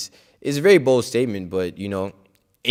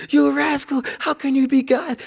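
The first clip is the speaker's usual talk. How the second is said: high, sharp retold scolding, much higher pitch; Prabhupada's angry rebuke. Guru rebuking a disciple who claims to be God, high angry shout. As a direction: berate him like a teacher who has lost patience. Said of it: "got on him".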